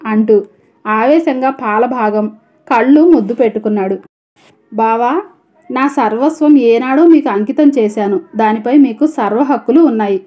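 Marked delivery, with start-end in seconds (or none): none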